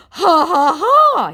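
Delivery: high, loud taunting ha-ha; mock scammer laugh. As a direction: laugh at them like a smug troll.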